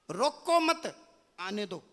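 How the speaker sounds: slow रोको मत, clear pause, then आने दो; group रोको with मत, then let आने दो fall; pause after मत. Shown as a demonstration. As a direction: break the phrase after मत. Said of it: "केवल कोमा आगे पीछे करने से सेंस बदल गई".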